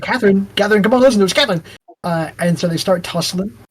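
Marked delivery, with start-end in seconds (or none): 0.0-1.6 pleading call. Retold or imitated. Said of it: "they're like".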